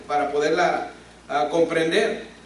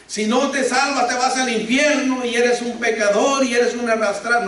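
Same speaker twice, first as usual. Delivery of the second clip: higher, louder condemning rant; mock harsh preacher; 5 semitones higher, 4 dB louder than usual. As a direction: parody a preacher threatening hell. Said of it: "No, no, no, espérenme". (imitando a un predicador que amenaza con el infierno, más alto y fuerte)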